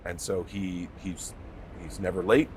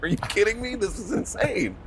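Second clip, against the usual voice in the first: excited disbelief; 13 semitones higher than usual. Retold or imitated. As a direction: gasp it out in amazement. Retelling his awed reaction on set.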